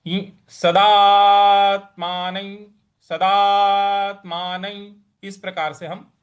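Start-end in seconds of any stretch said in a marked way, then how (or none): 0.1-4.9 सदात्मानं said slowly, ending with a y glide; teacher's slow सदात्मानं; pronunciation demo. Shown as a demonstration. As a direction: draw out सदात्मानं carefully. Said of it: "बिंदी इस तरह का मान करके".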